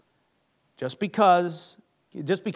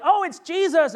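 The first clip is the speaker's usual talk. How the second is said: high, loud exclamation of discovery; surprised recognition; 10 semitones higher, 7 dB louder than usual. Acted. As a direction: cry out as you suddenly recognise him. Disciples recognising Jesus in relief.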